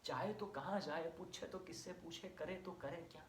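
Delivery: hushed, helpless questions; quiet helplessness. Acted. Speaking for children left stranded by the withdrawn movement, quietly helpless.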